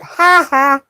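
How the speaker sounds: high, feminine demo laugh; put-on high little laugh. Shown as a demonstration. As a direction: pitch the laugh way up. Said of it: "make my pitch of my voice go higher".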